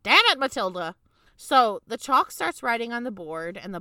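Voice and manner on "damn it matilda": frustrated exclamation. Retold or imitated. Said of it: "I was like".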